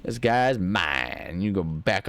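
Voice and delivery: slow silly voice. Pretend. Slow put-on voice.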